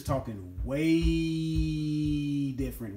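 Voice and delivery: "way" drawn out very long on a held note; stress on WAY. Real, not acted. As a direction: stretch "way" out long and slow before "different". One-upping the quoted remark with emphasis.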